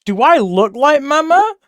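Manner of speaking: high, loud mock indignant; mock indignation. Streamer retelling her reaction to chat, high mock indignant. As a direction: play it scandalised. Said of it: "I was like".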